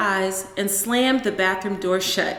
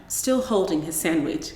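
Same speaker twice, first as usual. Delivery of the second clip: ordinary delivery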